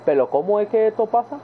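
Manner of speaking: put-on coastal Costa Rican accent, r turned to l; Yamil. Imitating his costeño friend, coastal Costa Rican accent. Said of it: "el acento como más costeño".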